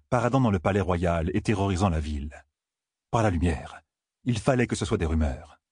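plain reading voice

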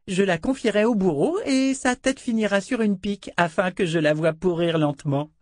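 put-on high, haughty little-girl voice; haughty child princess. A narrator's reading. Princess haughtily threatening Dena, high child voice. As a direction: lord it over her like a spoiled child. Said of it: "s'empourpra de colère".

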